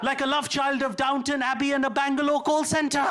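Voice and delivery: a light mix of posh English and Indian call centre accent; Indian-British hybrid accent. Shown as a demonstration. Comedian showing how Indians sound, light Downton Abbey and Bangalore accent. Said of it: "sounding like this".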